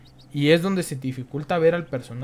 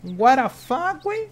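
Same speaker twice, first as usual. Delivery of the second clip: surprised; 7 semitones higher, slower than usual. Real, not acted. Surprised by something in game.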